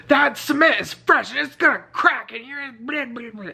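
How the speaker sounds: high, mock-frantic janitor; hammed-up janitor panic, trailing to blah blah; freaking out. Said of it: "freaking out".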